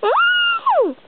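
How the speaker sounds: very high squeal of surprise; surprised gasp. Pretend. Child squeals at the found phone, surprised. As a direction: squeal it out, thrilled at the find.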